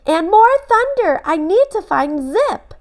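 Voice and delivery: put-on a small boy growing worried about his dog; little Ben fretting about the storm. A narrator's reading. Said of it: "said Ben".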